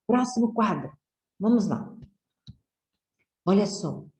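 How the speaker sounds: ordinary delivery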